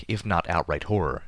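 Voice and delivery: ordinary delivery, nothing marked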